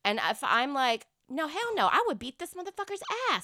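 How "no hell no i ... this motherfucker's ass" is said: high-pitched mock outrage; indignant parent. Imagining being his mother, mock indignant. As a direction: refuse emphatically, like an appalled parent.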